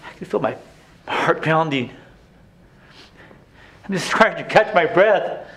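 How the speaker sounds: ordinary talk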